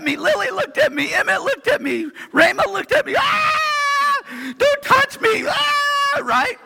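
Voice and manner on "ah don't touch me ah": mock child shrieking don't touch me; whiny kid squeal. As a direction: squeal like a kid poked by a sibling. Kids squealing.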